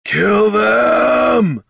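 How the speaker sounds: high, loud, drawn-out war shout; battle cry. Acted. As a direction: roar the order to attack.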